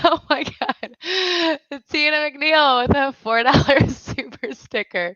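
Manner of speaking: high voice wobbling with real laughter; laughing to tears. Real, not acted. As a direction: gasp out the words between laughs. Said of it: "making my makeup run".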